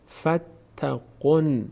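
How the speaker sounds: فتقن with ق joined straight to ن; slow recitation demo. Shown as a demonstration. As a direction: join ق to ن slowly in فتقن. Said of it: "از حرکت قاف کمک بگیریم برای خواندن نون".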